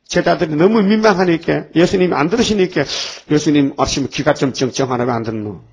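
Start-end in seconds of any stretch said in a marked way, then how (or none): none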